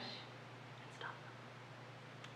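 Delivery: menacing whisper. Acted. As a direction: breathe the threat out softly.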